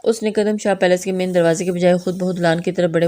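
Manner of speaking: ordinary delivery, nothing marked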